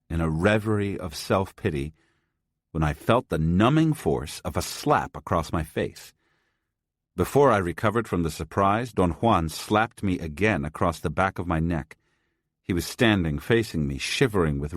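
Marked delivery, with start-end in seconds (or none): none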